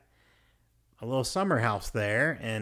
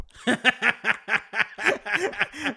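high burst of laughter; loud laugh; 11 semitones higher, 4 dB louder than usual. Real, not acted. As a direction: break into laughter. Laughing at constipation story.

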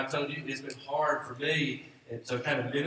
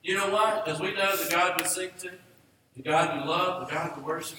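raised, higher, louder preacher's call to the room; fervent preaching; 6 semitones higher, 4 dB louder than usual. Acted. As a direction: preach it out to the whole congregation. Pastor preaching at full force.

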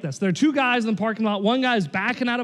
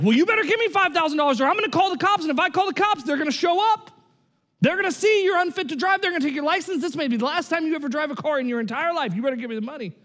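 mock threat; 6 semitones higher, faster than usual. Young guy threatening old man.

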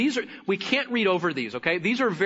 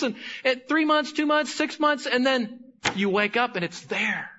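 fervent preaching; 5 semitones higher than usual. Acted. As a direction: preach it with rising fire. Preaching builds to high pitch.

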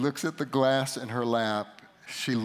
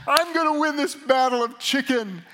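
much higher, louder triumphant boast; gleeful boast; 12 semitones higher, 8 dB louder than usual. Retold or imitated. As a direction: crow about winning, pumped up. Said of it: "the thought hits me".

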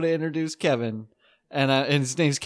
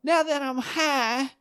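high, slow goofy dog voice; comic voicing his high dog; mock stoned dog voice; 11 semitones higher, slower than usual. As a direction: play the dog as a lazy stoner. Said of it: "he was like".

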